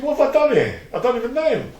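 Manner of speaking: high, panicked inner thought, replayed; retold panic. Retelling a prison fight to the interviewer, high panicked voice. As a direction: voice jumps high as he relives the dread.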